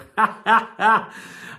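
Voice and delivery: high staccato cackle; loud, high laughing fit; amused laugh. Real, not acted. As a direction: burst out in high, rapid ha-has. Amused by his own catchphrase.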